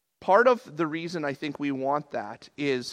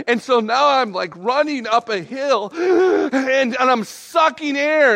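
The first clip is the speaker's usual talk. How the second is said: loud, high, straining retelling; worked-up, pushed-out comic struggle; mock exertion; 9 semitones higher, 9 dB louder, slower than usual. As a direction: act like you are gulping air on a hill. Reliving running up a hill.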